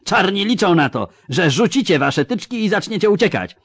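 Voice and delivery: loud, mocking commander addressing his troops; drwiący wojewoda. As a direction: sneer at the pikemen like a scornful noble officer. Voivode speech. (szyderczy wojewoda przemawia głośno do pikinierów)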